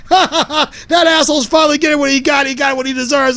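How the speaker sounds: high, jeering laugh into gloating; acted jeer from a laughing onlooker; mocking laughter. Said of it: "pointing and laughing, saying".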